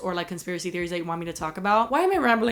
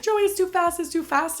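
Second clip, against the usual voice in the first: high, loud panicked yell; panicked scream; 9 semitones higher, 4 dB louder than usual. Retold or imitated. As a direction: scream in terror at speed. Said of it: "I just started screaming".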